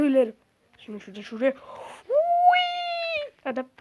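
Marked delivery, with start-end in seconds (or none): 2.1-3.3 high sustained yelp; real cry of alarm; startled cry. Real, not acted. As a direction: yelp in surprise. Startled by game.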